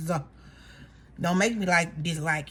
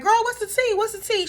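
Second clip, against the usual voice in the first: high, eager gossip squeal; mock eager girlfriend; nosy Britt; 12 semitones higher than usual. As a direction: beg for the gossip. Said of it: "Britt was like".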